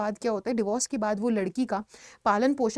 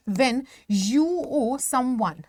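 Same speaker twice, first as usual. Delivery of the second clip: slow definition; much slower than usual. Shown as a demonstration. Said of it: "अब date होता क्या है?".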